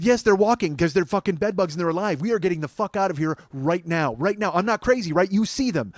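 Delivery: loud high alarmed rant; urgent panic, wanting out now; freaked out. Retold or imitated. Urging Jen to flee in a loud panic. Freaking out over bed bugs.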